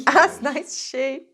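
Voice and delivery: laughs through the words, slowly; laughing. Real, not acted. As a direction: laugh at your own slip. Laughing at her own slip.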